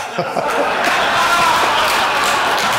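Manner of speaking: loud, high-pitched burst of laughter. Real, not acted. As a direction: crack up at yourself. Laughing at his own remark.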